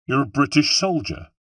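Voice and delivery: accusing confrontation. A narrator's reading. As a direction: confront the enemy with what you see. Hero confronts the knife man.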